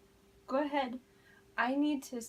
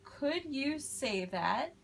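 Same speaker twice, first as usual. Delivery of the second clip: slow, clear model phrase; 3 semitones higher, slower than usual. Shown as a demonstration. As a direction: say it slowly for the class to copy. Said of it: "go ahead and say".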